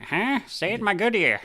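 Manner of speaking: loud, high-pitched comic shout; mock hard-of-hearing. Drummer with hearing loss answers the spouse, loud mock shout. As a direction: call back as if half deaf.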